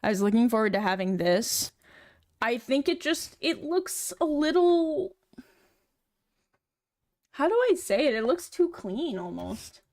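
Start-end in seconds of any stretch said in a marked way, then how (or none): none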